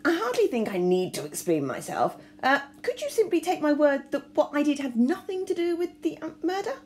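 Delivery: an embarrassed aristocrat stalling for time; caught-out lord stammering through coughs; flustered bumbling lord. A narrator's reading. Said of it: "Lord Hastings coughed".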